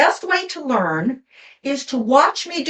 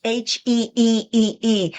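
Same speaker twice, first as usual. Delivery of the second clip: long heee sound. Shown as a demonstration. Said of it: "and the sound is".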